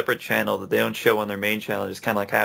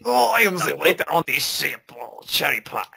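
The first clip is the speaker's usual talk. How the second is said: put-on higher-pitched makeshift French accent; 5 semitones higher than usual. Waiter on the ship, mock French accent. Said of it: "attempt to try and do a French accent".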